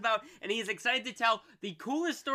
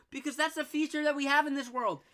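normal talking voice

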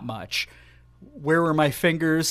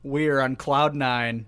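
ordinary talk

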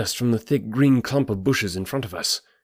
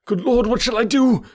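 high-pitched, anguished outcry; despairing cry; 13 semitones higher, 4 dB louder than usual. A narrator's reading. Frantic stranger with nowhere to turn, high despairing cry. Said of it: "in an ecstasy of despair".

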